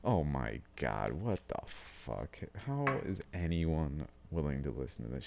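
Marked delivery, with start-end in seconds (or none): none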